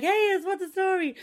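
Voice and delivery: a bouncy, hammed-up hiya; cheery greeting. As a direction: imitate a chirpy passer-by. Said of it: "I don't see someone be like".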